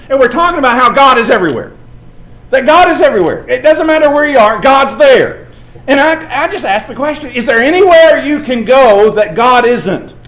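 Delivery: loud, high preacher's cry; preaching at full force. Acted. Preacher telling the congregation God is everywhere, proclaiming loud. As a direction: lift it up and proclaim it.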